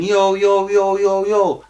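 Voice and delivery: high, loud yo called out through a cell door; imitated shouting. Cellmate shouting down the corridor for the guards, loud and high. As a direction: mimic a prisoner yelling for attention. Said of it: "Someone else starts shouting".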